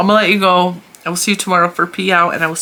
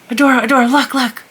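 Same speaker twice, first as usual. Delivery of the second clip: higher, raised voice to get her looking; calling out; 8 semitones higher, 4 dB louder, faster than usual. Retold or imitated. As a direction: excitedly call a child over, high and louder. Calling niece to look.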